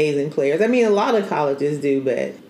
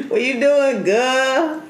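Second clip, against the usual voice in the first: Southern family greeting; 9 semitones higher, slower than usual. Retold or imitated.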